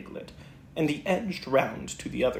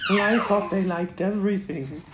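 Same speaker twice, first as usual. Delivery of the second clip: sad and regretful; 5 semitones higher, 4 dB louder than usual. Acted. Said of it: "tried to make it sound sad and regretful".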